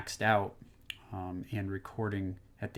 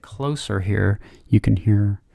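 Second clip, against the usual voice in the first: close-miked, louder and crisp; close to the mic; 11 dB louder, slower than usual. Shown as a demonstration. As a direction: lean right in on the mic. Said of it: "how crisp it is".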